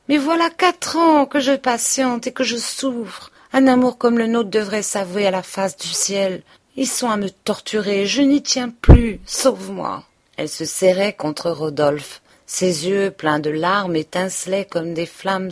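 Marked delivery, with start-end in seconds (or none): 0.1-10.1 higher, louder, pleading cry; desperate pleading lover. Acted. Emma pleading with Rodolphe to rescue her, desperate cry. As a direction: beg him as if drowning.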